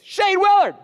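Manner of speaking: high, loud, taunting call of the name; mocking bully's call. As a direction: call him out like a schoolyard bully. Bully challenges the speaker to fight.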